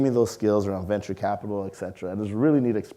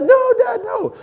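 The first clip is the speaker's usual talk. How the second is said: panicked denial; 9 dB louder, slower than usual. Retold or imitated. Kid denying to angry dad.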